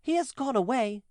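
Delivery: put-on children explaining the boy has left. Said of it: "answered the children".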